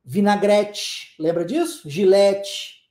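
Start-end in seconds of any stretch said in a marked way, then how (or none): 0.0-1.1 "Vinagrete" slowly, ending in a hissed "tch"; demonstrated "Vinagrete" with ch-like final T. Shown as a demonstration. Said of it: "pronunciando o T com som de tch". 1.9-2.8 "Gilete" ending in a hissed "tch"; demonstrated "Gilete" with ch-like final T. Shown as a demonstration. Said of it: "pronunciando o T com som de tch".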